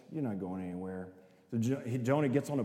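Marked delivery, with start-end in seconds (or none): 0.1-1.1 low, quiet and firm; God, flatly refusing to let him go; stern God voice. Retold or imitated. God stops Jonah fleeing, low and quiet. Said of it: "And God's like".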